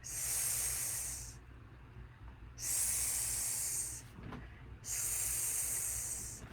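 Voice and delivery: soft long hissed "S", "s", "s"; drawn-out s like a snake. Shown as a demonstration. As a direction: hiss each "s" long and unvoiced. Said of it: "So we cue it like this".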